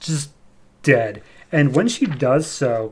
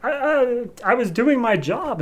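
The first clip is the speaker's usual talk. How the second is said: stammering, pitched way up and flustered; 11 semitones higher than usual. Acted. Fallon cornered after being punched, pitched-up flustered stammer. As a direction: stumble over the I's, caught off guard. Fallon caught out, defending herself.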